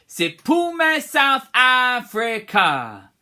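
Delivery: slow, high, loud call; leading the class. Shown as a demonstration. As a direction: call it out for them to repeat.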